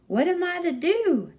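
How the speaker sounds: put-on Bill eyeing the kid, a bit alarmed; wary Bill.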